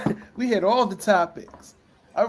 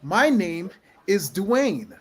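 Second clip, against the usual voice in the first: slow punchline; much slower than usual. Acted. Punchline claiming The Rock.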